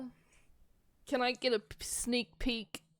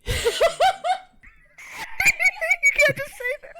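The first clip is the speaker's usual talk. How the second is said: laughing protest; 12 dB louder, faster than usual. Real, not acted.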